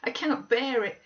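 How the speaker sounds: put-on a terrified boy begging his cousin; terrified sobbing boy. A narrator's reading. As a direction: plead through tears in panic. Linton voiced, terrified, sobbing.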